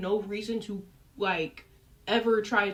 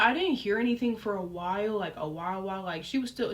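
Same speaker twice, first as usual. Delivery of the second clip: normal talking voice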